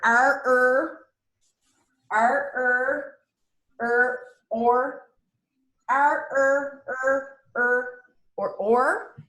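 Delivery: R, er, or said high and drawn out; sounding out R-vowels. Shown as a demonstration. Teacher shows the class the vowel plus R sounds, sounded out R, er, or. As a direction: isolate each sound: R, er, or. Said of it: "vowel plus R words".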